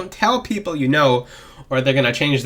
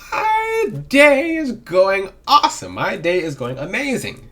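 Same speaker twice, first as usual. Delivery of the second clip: high, slow and louder, beaming; upbeat high voice; 5 semitones higher, 4 dB louder, slower than usual. Shown as a demonstration. Vlogger demoing an optimistic voice to viewers, bright and high. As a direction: sell how great your day is. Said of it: "I hope you get the trend".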